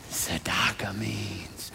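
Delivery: unvoiced secretive whisper. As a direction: lean in and whisper it. Whispered reveal of meaning.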